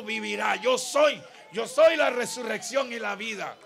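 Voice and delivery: loud declaration, rising to a shout; full-force preaching. Real, not acted. Preacher declaring Jesus's word against death, full-force preaching. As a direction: proclaim it with full pulpit force.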